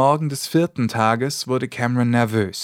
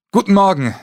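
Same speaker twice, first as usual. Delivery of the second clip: 1 loud greeting call; 9 semitones higher, 7 dB louder, faster than usual. Acted. Continues calling to butler.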